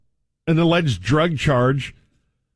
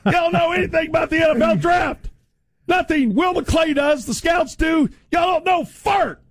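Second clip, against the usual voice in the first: real shouting rant; furious shout; 10 semitones higher than usual. Real, not acted. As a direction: yell it at the owners. Shouting at the owners.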